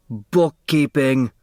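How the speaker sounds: slow, mocking exclaimed word; whooping laugh. A narrator's reading. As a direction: crow the word Bookkeeping with glee. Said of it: "let out a whoop".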